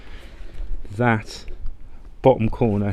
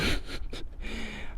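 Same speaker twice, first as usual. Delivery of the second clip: breathy suppressed laugh; quiet snort of a held-in laugh; stifled giggle; 10 dB quieter than usual. Real, not acted. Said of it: "I'm sorry, I'm trying not to laugh".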